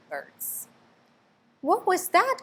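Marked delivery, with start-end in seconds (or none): 1.6-2.4 put-on high, surprised baby-bird cry; a chick startled by the crash. A narrator's reading. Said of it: "cried Flap".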